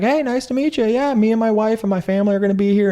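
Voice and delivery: high excited Martin Short impression. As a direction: greet him like a keen dad. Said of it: "He's like".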